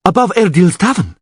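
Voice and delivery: high, urgent call; pointing-out shout. A narrator's reading. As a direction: call out the location. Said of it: "shouted Karayadan".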